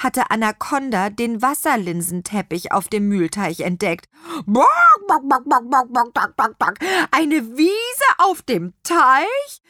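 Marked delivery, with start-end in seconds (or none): 4.4-9.6 delighted hen voice, high and stretched; excited clucking hen. A narrator's reading. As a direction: cluck like a thrilled chicken, then marvel loudly. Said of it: "gackerte das Huhn begeistert".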